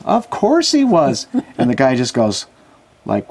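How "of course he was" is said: high, slow, drawn-out of course; emphatic mock answer.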